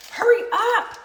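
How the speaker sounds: loud, high-pitched impatient yell; angry shout. Real, not acted. Hungry and angry at herself, shouting. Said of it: "I'm actually angry at myself".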